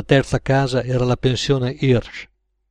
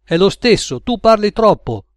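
high, loud scolding; raised, annoyed rebuke; irritated retort; 8 semitones higher, 5 dB louder than usual. A narrator's reading. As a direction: snap back at a talkative partner. Gunmen bicker.

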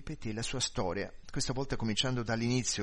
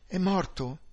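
higher-pitched, mild exclamation; exclaimed question; 7 semitones higher, 4 dB louder than usual. A narrator's reading. Wife asks policeman about husband, mildly exclaiming. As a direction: lift the pitch into an anxious question. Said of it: "esclamò lei".

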